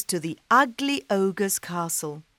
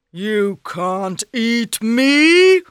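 scoffing ogre; 4 semitones higher, 9 dB louder, much slower than usual. Acted.

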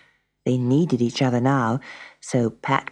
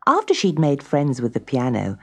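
ordinary delivery, nothing marked

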